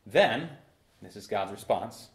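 ordinary talk

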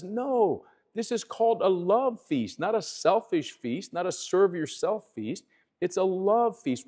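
Paul's correction proclaimed high and firm, "No" lifted sharply; preaching rebuke. Acted. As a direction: rebuke the church from the pulpit, fervent.

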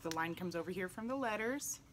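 ordinary delivery

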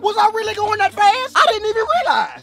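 high, playing innocent; fake innocent. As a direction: act shocked and sorry.

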